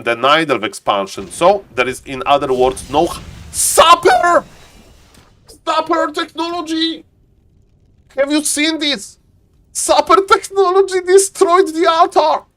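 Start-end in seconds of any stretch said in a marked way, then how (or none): 3.5-9.2 high-pitched, drawn-out commentator cry; excited exclamation. Real, not acted. Match commentator reacts to in-game play. 9.7-12.4 very high-pitched excited call; excited commentary. Real, not acted. Match commentator reacts to in-game play.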